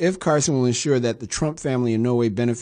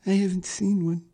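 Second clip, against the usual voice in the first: mocking repeat in a put-on higher voice; mocking replay of the nominee's answer; mocking Carson; 8 semitones higher than usual. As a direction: parody the quote with a sneer.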